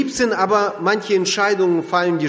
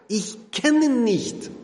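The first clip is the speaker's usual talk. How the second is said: slow, higher, every word sworn; sworn denial; 6 semitones higher, much slower than usual. Retold or imitated. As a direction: swear it slowly, word by word. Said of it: "dass er schwört und sagt". (langsam geschworen, Wort für Wort)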